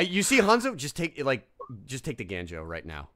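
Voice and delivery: ordinary talk, nothing marked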